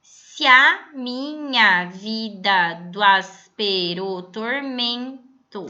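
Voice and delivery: slow, measured verse reading; teacher reading a sonnet line slowly; slow scansion reading. Shown as a demonstration. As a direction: read the line slowly, syllable by syllable. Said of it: "Reparem no primeiro verso".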